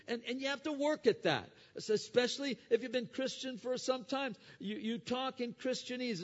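loud, high, ringing sermon voice; preaching at full force. Acted. Preacher urging the congregation to repent, loud and soaring. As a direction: thunder it from the pulpit. Preacher raises pitch again.

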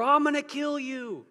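put-on high childish threat; mock kids yelling. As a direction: play the angry kid screaming it. Kids screaming at each other.

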